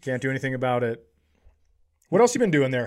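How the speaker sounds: ordinary talk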